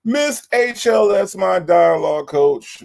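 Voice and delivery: high, loud, slow ringmaster intro; grand introduction. Real, not acted. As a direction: present her like a star.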